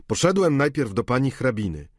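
servant's report. Acted. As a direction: rattle off the report.

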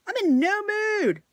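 high exasperated snap. Real, not acted. Ranting at horn-beeping driver.

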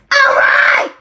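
high, loud cheer; excited shout. Real, not acted. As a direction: yell it out in triumph. Kid cheering loudly.

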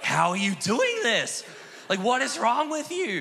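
high, drawn-out mock outcry; appalled team. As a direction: imitate teammates aghast at you. Voices his appalled team.

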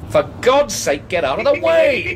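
explosive yell, pitch shooting up; angry shout. Acted. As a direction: lose patience with the cat entirely. Shouting at the cat.

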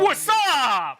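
high, stretched-out mock greeting; playful yell. Yelling a greeting at the reveal, playful yell. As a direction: holler it high and long, for fun.

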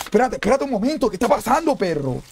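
high-pitched, rattled protest; real alarm. Real, not acted. Gamer asking what is happening, alarmed. Still shaken after the scare.

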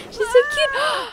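high, loud squeal of delight; gushing. Real, not acted. Gamer squealing over her cute Sim, excited. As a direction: squeal it out, totally charmed.

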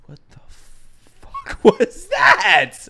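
slow, high, shouted disbelief through laughter; laughing shout. Real, not acted. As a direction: yell it while cracking up. Shocked by the scene.

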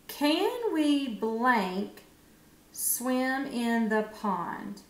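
very slow, word by word; slow reading with blank. Shown as a demonstration. Teacher slowly reads the gapped sentence to children. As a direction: read it slowly with the gap. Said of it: "let's just read it".

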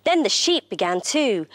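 ordinary delivery

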